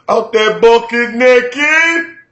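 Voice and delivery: high, slow, loud holler; yelled catchphrase. Retold or imitated. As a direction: stretch every word into a mimicked comic yell. Quoting a comedian's yelled catchphrase.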